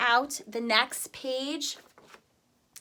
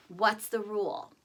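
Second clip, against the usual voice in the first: ordinary delivery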